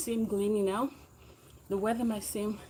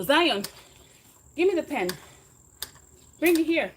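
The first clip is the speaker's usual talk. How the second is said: higher and louder, calling across the room; 7 semitones higher, 8 dB louder than usual. Real, not acted. Calling child to bring pen.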